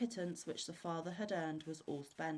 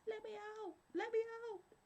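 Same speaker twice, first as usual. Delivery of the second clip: very high, muffled little trapped-spirit voice; tiny spirit squeaking from inside a bottle; smothered cry; 4 dB quieter than usual. A narrator's reading. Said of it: "crying in a very smothered voice".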